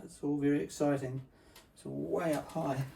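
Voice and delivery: usual speaking voice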